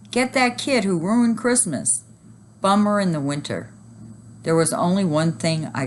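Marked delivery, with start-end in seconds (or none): 0.1-2.0 high, pressing cry; angry mob shout. A narrator's reading. Angry shopper rousing the crowd, high shout. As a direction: point him out to the mob. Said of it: "somebody shouted".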